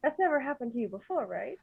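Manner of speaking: soft, confidential check; hushed question. Acted. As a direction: ask the pony gently and quietly. Whispering into the pony's ear.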